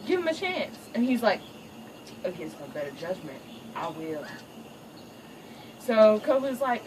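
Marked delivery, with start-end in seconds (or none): 0.1-0.8 pleading wife. Retold or imitated. Nala pleading with Simba. 2.2-4.2 low, soft reluctant mutter; grudging father. Retold or imitated. Father reluctantly gives in to wife, low and grudging.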